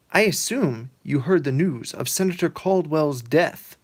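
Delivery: a touch of solemn official; governor voice. Retold or imitated. Governor telling Pete of the death, mildly grave.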